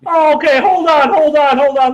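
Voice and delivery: loud, high, hurried hold on; urgent hold on. Real, not acted. Streamer stops his co-host, loud and high. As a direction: stop everyone right away. Startled, calling to wait.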